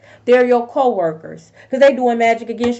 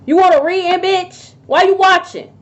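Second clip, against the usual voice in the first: confrontational; 6 semitones higher, 3 dB louder than usual. Real, not acted. Confronting nosy neighbours.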